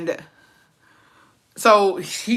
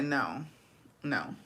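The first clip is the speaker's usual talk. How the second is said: low, soft deadpan no; quiet refusal; 5 semitones lower, 13 dB quieter than usual. Retold or imitated. Retelling her own unimpressed refusal.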